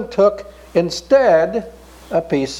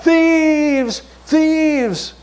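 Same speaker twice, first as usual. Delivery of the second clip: crying out; 10 semitones higher than usual. Acted. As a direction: call for help in alarm. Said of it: "They began to cry out".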